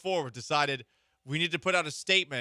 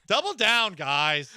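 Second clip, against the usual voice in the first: laughing loud, higher; 6 semitones higher, 6 dB louder, slower than usual. Real, not acted. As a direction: laugh through it. Laughing at own joke.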